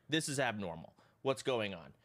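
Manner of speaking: ordinary delivery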